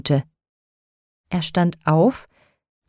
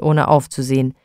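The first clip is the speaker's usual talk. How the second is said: usual speaking voice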